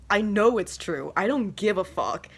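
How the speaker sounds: usual speaking voice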